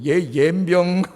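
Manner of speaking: a hint of an old mother cursing; mock cursing mother. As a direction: toss off a curse like a country mother. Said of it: "어머니들이 욕하다". (가볍게 흉내 낸 욕하는 엄마)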